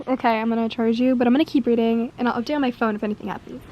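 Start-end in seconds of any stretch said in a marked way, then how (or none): none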